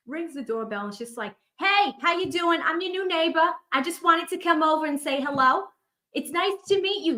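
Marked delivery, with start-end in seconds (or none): 1.6-7.2 put-on high, loud, chirpy Boston accent; Boston lady barging in, loud and high Boston accent; Boston neighbor impression. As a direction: play the nosy Boston neighbor greeting at the door. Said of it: "she has the craziest Boston accent".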